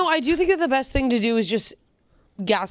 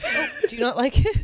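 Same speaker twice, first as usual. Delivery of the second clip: laughing; 10 semitones higher than usual. Real, not acted. Laughing at a friend choking.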